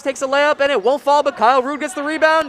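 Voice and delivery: high, loud rapid-fire game call; excited play-by-play. Real, not acted. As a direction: call the fast break live. Live play-by-play continues.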